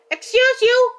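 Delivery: offended. Acted. Mock offended at rude NPC.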